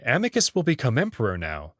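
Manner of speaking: ordinary delivery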